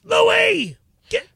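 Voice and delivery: high, loud yelled exclamation; sudden high shout of a name; Danny DeVito. Shown as a demonstration. Impressionist doing a requested voice for radio hosts, Danny DeVito. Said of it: "Danny DeVito".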